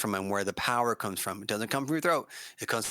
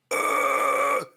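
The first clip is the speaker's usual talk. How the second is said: louder airy rasp; breathy fry scream; 3 semitones higher, 6 dB louder than usual. Shown as a demonstration. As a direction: rasp out on air. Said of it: "you start here".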